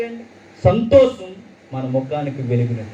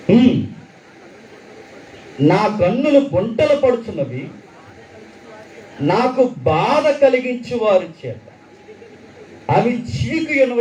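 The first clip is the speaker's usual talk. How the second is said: scripture reading; 7 semitones higher than usual. Acted. Reads verse seven with feeling.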